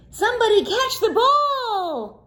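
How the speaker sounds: high, urgent call; team call. Acted. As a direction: call out across the court. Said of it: "cried Sammy's team".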